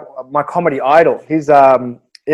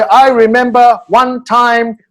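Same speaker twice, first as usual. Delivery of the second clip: slow, higher-pitched, word-by-word recital; language-class English; 5 semitones higher, 4 dB louder, much slower than usual. Retold or imitated. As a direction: imitate a learner carefully addressing the class. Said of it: "facilitating an English language course".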